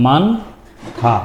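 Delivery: মানথা very slowly, a long gap between মান and থা; drawn-out teaching model of মানথা; syllable-by-syllable demo. Shown as a demonstration. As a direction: break মানথা into মান, pause, থা. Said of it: "আমরা একটু উচ্চারণ করে আসি".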